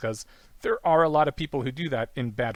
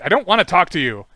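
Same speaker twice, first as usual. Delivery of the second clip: loud, pitched-up brush-off; mock irritated refusal; 5 semitones higher, 10 dB louder than usual. Woman refusing to talk to her challenger, loud mock refusal. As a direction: push them away loudly, play-acting.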